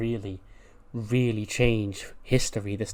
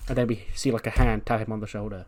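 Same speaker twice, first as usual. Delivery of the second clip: ordinary delivery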